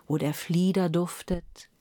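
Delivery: plain reading voice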